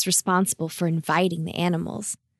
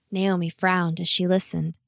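usual speaking voice

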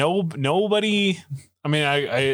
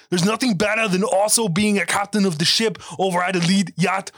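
Arn voice; 8 semitones higher than usual. Retold or imitated. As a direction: shout it like an overexcited Arn. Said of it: "I don't do the best Arn in the world".

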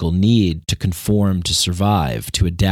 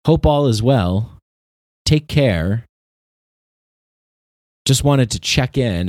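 mock-polite email sign-off, raised a little; fake-nice email voice; 4 semitones higher than usual. Podcaster reading a fake-polite email in mock tone. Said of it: "people still talk like that".

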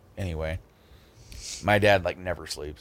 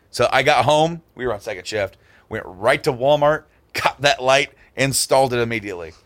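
ordinary talk

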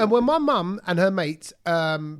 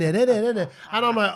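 ordinary talk

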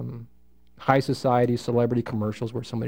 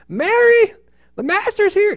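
high, loud call across the house; shouting call; 7 dB louder than usual. Acted. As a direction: yell her name out so everyone hears. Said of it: "Did she say it like that?".

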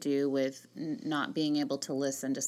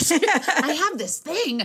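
laughing, pitched up, slower; 12 semitones higher, 6 dB louder than usual. Real, not acted. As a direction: giggle through the line. Laughing about younger husband.

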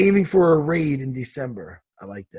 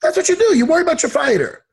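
much higher, indignant insistence; worked-up rant; 9 semitones higher, 3 dB louder than usual. Real, not acted. Fighter lecturing a coach on his job, worked up. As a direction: drive it home, pitch soaring.

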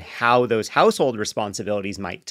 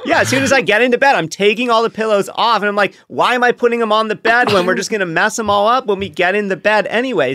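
excited rant; 8 semitones higher, 6 dB louder than usual. Real, not acted.

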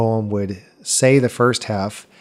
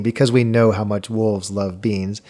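ordinary delivery

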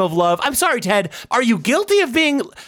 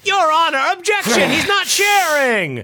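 shouted playful objection; mock courtroom objection; 5 semitones higher than usual. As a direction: yell out the objection in jest.

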